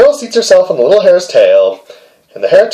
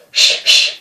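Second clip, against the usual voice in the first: shushing. Acted. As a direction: hush the hare away gently. Girl shooing the hare.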